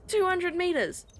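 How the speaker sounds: high, loud disbelief; shocked. Real, not acted. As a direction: blurt it out in disbelief. Shocked echo of game depth.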